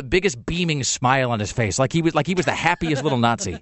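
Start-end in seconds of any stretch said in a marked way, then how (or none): none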